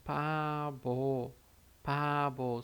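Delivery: "pabo" with P first, then B sound; Korean word demo. Shown as a demonstration. Teacher repeating for students, slow and clear. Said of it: "Então fica".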